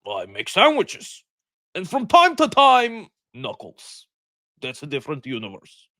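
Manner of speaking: dark voice, lightly put on; a hint of a dark, dramatic character voice; Idris Elba. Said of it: "give him such a dark voice".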